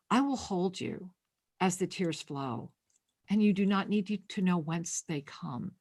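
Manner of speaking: angel's gentle message spoken softly; guided channeling. Acted. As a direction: ease her into the vision.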